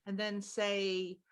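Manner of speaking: ordinary delivery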